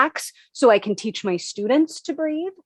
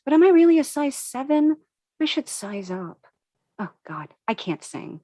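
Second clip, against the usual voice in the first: plain reading voice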